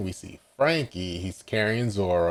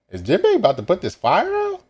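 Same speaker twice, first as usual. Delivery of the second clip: much higher, louder, quick question; excited surprise; 12 semitones higher, 7 dB louder, faster than usual. Real, not acted. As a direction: burst out in high-pitched disbelief. Reader reacts to surprising manga turn.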